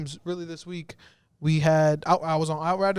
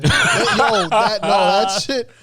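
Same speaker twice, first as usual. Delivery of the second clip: loud, real laughter through the words; laughing; 3 semitones higher, 8 dB louder, slower than usual. Real, not acted. Friend recalling Robo's beating on stream, laughing loudly. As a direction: crack up while talking. Laughing over Robo story.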